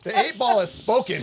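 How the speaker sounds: high-pitched, amused pronouncement; mock proclamation. Announcing the eight ball's ruling to friends, mock-solemn. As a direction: proclaim it like a verdict, half laughing.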